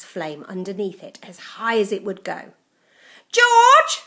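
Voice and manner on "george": shrill call from the next room; from another room. A narrator's reading. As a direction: call out loud and high from afar. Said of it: "came the awful voice from the next room".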